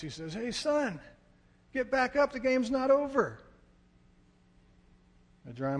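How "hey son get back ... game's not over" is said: high, raised father's call across a field; rally your son from afar; dad calling out. Retold or imitated. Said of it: "I hear my dad's voice".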